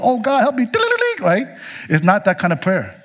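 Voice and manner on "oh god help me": desperate plea. Retold or imitated. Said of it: "something bad happens and we go".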